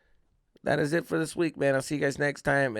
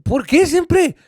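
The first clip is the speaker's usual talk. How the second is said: high, drawn-out whiny protest; hammed-up wail of innocence; mock crybaby; 10 semitones higher, 4 dB louder, slower than usual. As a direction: play the falsely accused crybaby, pitched way up. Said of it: "I was freaking Mister Crybaby".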